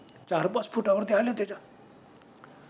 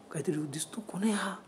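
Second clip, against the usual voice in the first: hushed, breathless whisper; frightened whisper; 7 dB quieter than usual. Acted. Ganu whispers to Nanabhau about the shape, scared. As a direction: whisper so the figure won't hear. Scared boy sees figure at night.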